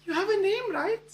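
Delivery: put-on high European-accented prompting. Future wife prompting the speechless man, European accent. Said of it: "delightful European accent".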